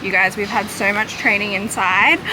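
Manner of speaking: ordinary talk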